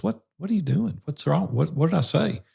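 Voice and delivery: lightly voiced wife pressing him gently; worried wife voice. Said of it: "She goes".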